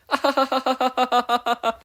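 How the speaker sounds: high loud manic laugh; manic salesman cackle. Acted. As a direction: cackle like a broken ad.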